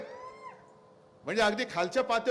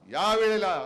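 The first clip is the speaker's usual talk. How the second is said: shouting; 6 dB louder, slower than usual. Acted. Rally climax, shouted.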